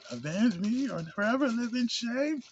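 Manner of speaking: high, slow, hammed-up vengeful father; mock grave father. Reviewer quoting the dying father's oath, slow mock-solemn high voice. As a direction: intone it like an over-solemn ghost king, slowly. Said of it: "I gotta do this".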